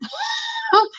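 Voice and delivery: a sudden squealing laugh, high and bright; one high whoop of laughter; high shrieking laugh. Real, not acted. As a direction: burst into a shrill laugh. Laughing after point.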